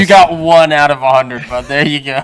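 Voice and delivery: teasing laugh. Real, not acted. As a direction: tease him through a laugh.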